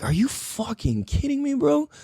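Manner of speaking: high incredulous are you kidding me bro. Retold or imitated. Hearing the doctor is only forty, high incredulous. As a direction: shoot up high in disbelief on bro. Retelling amazement at his age.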